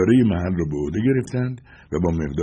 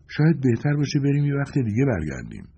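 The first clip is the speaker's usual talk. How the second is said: higher-pitched companion voice; Joanna's voice; 7 semitones higher than usual. A narrator's reading. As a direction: voice her high, then let it fall at the end. Voicing Joanna, suggesting they leave. (صدای زیرتر شخصیت همراه)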